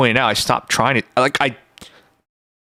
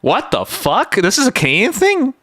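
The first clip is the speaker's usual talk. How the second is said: incredulous question, voice shooting up; surprised disbelief; 7 semitones higher than usual. Real, not acted. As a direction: pitch jumps high in shocked disbelief. Learns of an accent trait.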